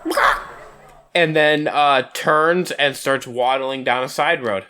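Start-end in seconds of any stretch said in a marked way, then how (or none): 0.1-0.4 short, high chicken squawk; one sudden high-pitched bird noise; chicken cluck. Acted. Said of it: "looks over at you and goes".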